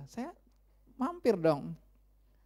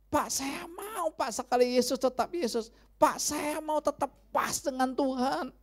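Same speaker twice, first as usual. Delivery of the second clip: a churchgoer begging the pastor, higher and earnest; eager believer; 8 semitones higher than usual. Pretend. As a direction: plead your wish to be perfect with God. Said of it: "Mungkin kita berkata".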